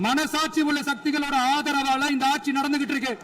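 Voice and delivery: high-pitched, insistent assembly oratory; sustained high, emphatic rebuttal. Real, not acted. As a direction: pitch it up high so the whole chamber hears. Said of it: "நான் ஏன் கொஞ்சம் சவுண்டா பேசுறேன்".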